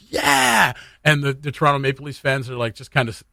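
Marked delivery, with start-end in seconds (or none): 0.1-0.7 high-pitched drawn-out cheer at normal volume; imitated fan cheer. Host voicing Oilers fans celebrating a goal, imitated high cheer. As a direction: mimic thrilled fans celebrating, pitched well up. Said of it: "Oilers fans in the picture are like".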